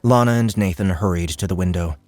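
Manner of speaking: ordinary delivery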